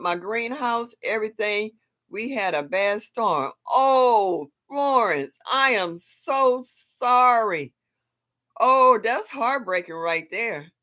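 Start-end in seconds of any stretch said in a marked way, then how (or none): 3.7-10.7 sympathy. Real, not acted. Friend lost garden in storm.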